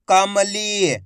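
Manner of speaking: कमली read out with a long ई; drawn-out long ई. Shown as a demonstration. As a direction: stretch the final ली of कमली. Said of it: "खींच के पढ़ा जाता है".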